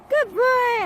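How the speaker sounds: high, loud praise; excited praise. Real, not acted. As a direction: reward the dog with your voice. Praising recall.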